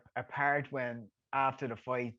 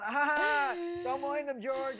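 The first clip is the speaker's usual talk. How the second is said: embarrassed laugh; 12 semitones higher, much slower than usual. Retold or imitated. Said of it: "I was going".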